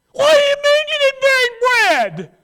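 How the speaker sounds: high, loud, slow frantic squawk; hammed-up panicked outburst; a preacher playing Jesus losing it over bread; mock panic.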